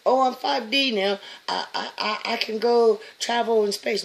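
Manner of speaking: high, loud, fast silly mimic; mock the boastful claim; sarcastic high mimicry; mocking quote. Mocking naive ascension claims.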